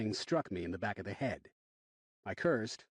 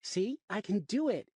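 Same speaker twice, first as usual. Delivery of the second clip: put-on higher, slow proud child; proud little girl; 9 semitones higher, slower than usual. A narrator's reading. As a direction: show off like a four-year-old. Daughter proud on swing.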